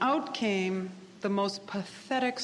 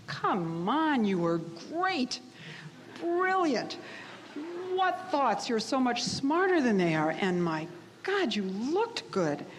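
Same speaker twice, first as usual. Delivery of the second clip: higher, gushing praise in bursts; doting dad; 5 semitones higher than usual. Retold or imitated. Dad reassuring his daughter after her flop, gushing. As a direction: imitate a dad hyping up his kid. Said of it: "My dad, being a dad".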